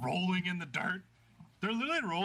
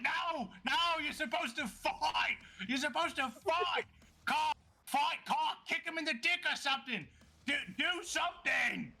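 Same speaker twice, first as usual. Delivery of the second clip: high, frantic yelling at the fighters; frantic announcer; 11 semitones higher than usual. Acted. Connell yells at the wrestlers to fight, shrill and frantic. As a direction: beg them to fight, nearly screaming. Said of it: "And Connell's like".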